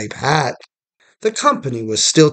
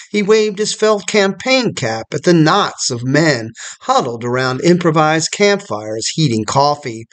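plain reading voice

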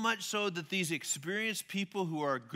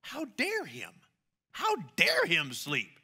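mock outrage; 2 semitones higher, 6 dB louder, slower than usual. Mock outrage at sleeping Jesus.

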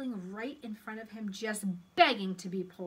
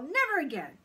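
put-on loud, high boyish refusal; emphatic child's protest; firm refusal; 7 semitones higher, 8 dB louder than usual. A narrator's reading. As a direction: shut the temptation down hard. Paul refuses the pigtail.

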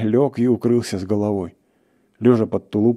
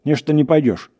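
high, raised challenge; acted indignant call; impatient shout; 6 semitones higher, 4 dB louder than usual. A narrator's reading. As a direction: press a reluctant companion loudly. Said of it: "крикнул охотник на лесника".

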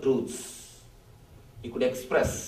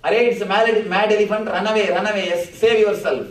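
louder, higher urgent shout; shouting a warning; 5 semitones higher, 8 dB louder, faster than usual. Acted. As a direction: shout the alarm to get people running. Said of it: "he shouts".

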